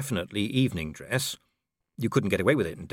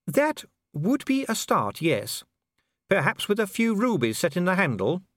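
a noble lady musing aloud; Lady Sybil; 10 semitones higher than usual. A narrator's reading.

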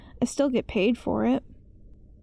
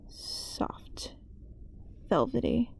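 barely-there whisper; 9 dB quieter, slower than usual. Acted. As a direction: let the word dissolve into breath. Eerie description of the wall.

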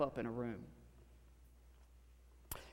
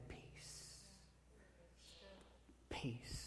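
whispered peace; 5 semitones higher, 20 dB quieter than usual. A narrator's reading. Preacher voicing Jesus to racing minds.

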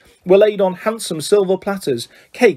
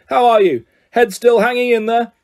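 raised, hearty mock-roared greeting; roaring; 5 semitones higher than usual. A narrator's reading. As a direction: greet him boisterously at a higher pitch. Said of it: "he roared".

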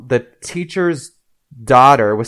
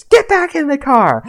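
high-pitched mock mom yell; retold yell; 13 semitones higher, 3 dB louder than usual. Mom to girl jumping out of the van, mock yelling. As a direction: imitate a mother yelling an urgent order. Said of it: "And the mom's yelling".

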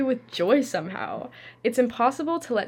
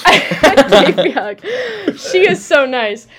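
laughing; 4 semitones higher, 13 dB louder than usual. Real, not acted. Reading a funny entry aloud.

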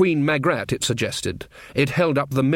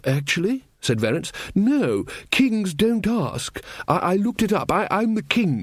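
Verence's voice; 5 semitones higher than usual. Acted. King Verence scolding the trumpeter, higher, fussy. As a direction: play the earnest little king going on about pigs.

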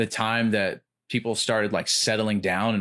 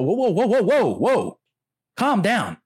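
high, loud mock alarm; alarmed crowd; 12 semitones higher, 6 dB louder than usual. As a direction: play the shocked audience yelling whoa. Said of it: "And people are".

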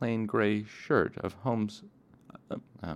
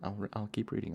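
usual speaking voice

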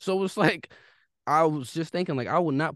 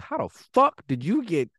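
incredulous outburst; 3 semitones higher, 3 dB louder than usual. Retold or imitated. Baffled outburst over name mix-up.

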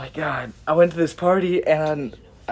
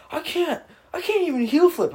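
much higher, whining protest; whiny high voice; 13 semitones higher than usual. Retold or imitated. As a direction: pitch up like a whiny friend. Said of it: "And he goes".

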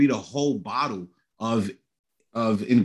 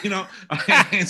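laughing; 6 semitones higher, faster than usual. Real, not acted. Laughs recalling his reading.

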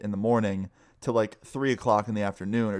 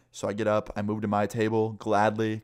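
ordinary delivery, nothing marked

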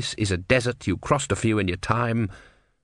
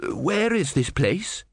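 slow hoarse frightened question; 7 semitones higher, slower than usual. A narrator's reading. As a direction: rasp it out, shaken. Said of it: "he said hoarsely".

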